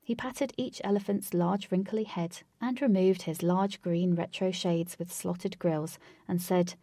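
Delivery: ordinary delivery, nothing marked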